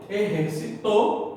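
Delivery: "exercitou" as Spanish "eh-her-si-TO"; stress on final syllable. Shown as a demonstration. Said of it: "é aguda".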